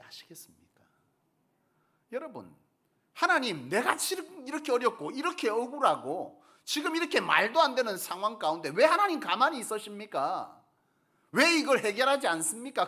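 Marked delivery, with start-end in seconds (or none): 3.1-12.9 anguished plea. Acted. Preacher voices a believer's complaint.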